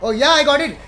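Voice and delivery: loud, high burst of relief at finding the number; excited outburst. Real, not acted. Streamer finds the saved contact, excited loud relief. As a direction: blurt it out, thrilled it finally worked.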